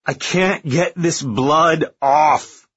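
slow, high, loud and breaking; hysterical. Acted. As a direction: drag it out, near breakdown. Repeats in horror, slowed.

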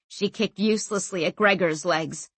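ordinary delivery, nothing marked

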